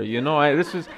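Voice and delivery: high, loud retold refusal; mock indignant rant. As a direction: act out refusing to endorse it. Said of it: "he storms out".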